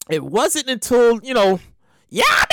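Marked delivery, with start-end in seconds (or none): 2.1-2.5 calling out. Retold or imitated. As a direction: call the name out high. Search party calling out.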